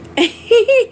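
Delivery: high giggle; real laughing. Real, not acted. Speaker amused by a viewer's comment, giggling. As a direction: burst into a high, genuine giggle. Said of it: "You got me laughing".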